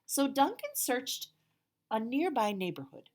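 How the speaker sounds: put-on picture book read to children; storybook narration. A narrator's reading. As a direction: tell it to the kids with big energy. Reads the picture book aloud.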